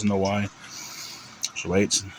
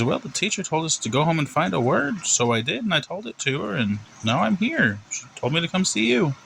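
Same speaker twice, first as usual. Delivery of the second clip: put-on high, sing-song kid's explanation; innocent little boy; 9 semitones higher than usual. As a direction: recount it as an innocent boy to the principal. Plays Timmy explaining.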